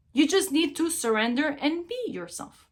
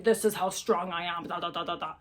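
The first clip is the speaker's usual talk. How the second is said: lower, softer mock-tough voice; macho boast; 7 semitones lower, 6 dB quieter than usual. The strong male animal showing off to the female, in a low mock-tough voice. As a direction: puff up like a strutting male, pitched down.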